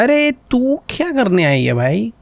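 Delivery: ordinary talk, nothing marked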